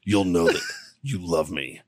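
mock a creep murmuring sweet nothings; imitating a creepy seducer, low and hushed; creepy seductive whisper. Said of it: "he's like".

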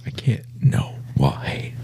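whispered, hammed-up disbelief; mock despair. Viewer reacting to an episode's ending, mock-despairing whisper.

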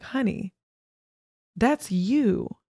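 retold gentle pointing-out, deliberate; therapist's knowing reveal. Therapist telling her client the child mirrors her, knowing.

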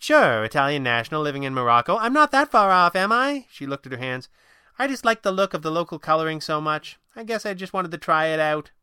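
a hint of a breezy young woman; lightly voiced young woman. A narrator's reading. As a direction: read Kit as the narrator's light young woman voice. Narrator voices Kit.

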